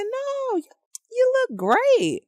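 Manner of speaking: very high-pitched mock reassurance; reassuring spouse. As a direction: squeal a drawn-out, overdone denial. Voicing a spouse reassuring falsely.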